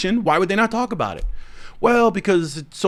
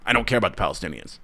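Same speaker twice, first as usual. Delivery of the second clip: quick, lower, softer sneering imitation; mock smug rich man; 7 semitones lower, 5 dB quieter, much faster than usual. Vlogger mocking a rich celebrity, in a smug imitation. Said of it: "talk like that".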